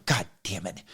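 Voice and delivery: under your breath, annoyed; muttered curse. Retold or imitated. Sees proctor coming.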